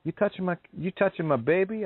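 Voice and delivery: a hint of a riled-up mom defending her child; protective mama. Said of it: "mama saying".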